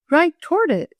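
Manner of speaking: exclaiming. A narrator's reading. Boy tells the girl of her danger, exclaiming. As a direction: exclaim it with urgency. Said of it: "exclaimed Will".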